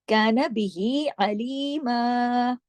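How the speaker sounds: slow melodic chant of काना बिही Aleema; Quran recitation. Shown as a demonstration. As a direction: recite it slowly and sung. Recites phrase.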